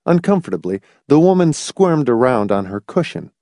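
ordinary delivery, nothing marked

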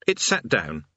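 usual speaking voice

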